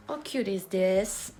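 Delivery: slow, drawn-out English exclamation; English outburst. Real, not acted. Vlogger struggling with henna, drawn-out English outburst.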